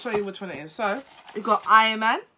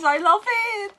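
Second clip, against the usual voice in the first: excited; 10 semitones higher, 5 dB louder than usual. Real, not acted. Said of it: "I'm getting too excited".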